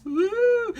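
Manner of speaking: high siren-like "woo"; mock alarm sound. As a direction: whoop like a pretend alarm effect. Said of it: "a special sound effect for heterosexuals".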